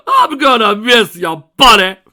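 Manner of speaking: wailing, very loud tearful farewell; loud sobbing. Acted. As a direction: bawl it out as you hug him. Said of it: "cried the Alicorn".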